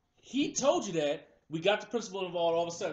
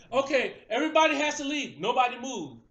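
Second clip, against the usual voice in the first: loud, raised barking commands; officer shouting orders; 6 semitones higher, 7 dB louder than usual. Retold or imitated. Cop yelling at the kids in line, loud. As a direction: bark orders like an angry cop.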